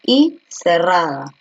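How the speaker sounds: slow, naming each vowel in turn; teacher breaking veía into vowels one by one; slow analysis. Shown as a demonstration. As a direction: step through E and I deliberately. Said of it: "Ahora voy a analizar uno por uno".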